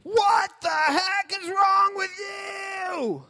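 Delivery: high, drawn-out mock shout; hammed-up yell, stretching every word; angry dad. Dad explodes at his child, hammed-up angry shout. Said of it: "maybe your dad's more just like".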